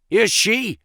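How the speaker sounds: high, sharp indignant cry; angry cry. A narrator's reading. Baldwin outraged at the ruling on the girl, angry cry. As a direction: fling the question back angrily. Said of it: "cried Baldwin".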